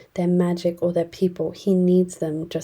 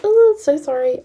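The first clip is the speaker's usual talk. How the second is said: higher, louder sing-song sorry; fake apology; 9 semitones higher, 7 dB louder than usual. Scullery maid stabbing her, falsely sweet apology.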